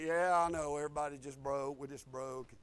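low, soft, resigned mumble; hammed-up dejected murmur; mock defeat. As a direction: imitate someone giving up, voice sinking away. Mimics defeated talk of broke people.

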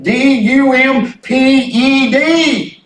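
letters spelled one by one, slow; slow, deliberate spelling of D U M P E D; spelled out slowly. Shown as a demonstration. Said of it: "the business will be dumped".

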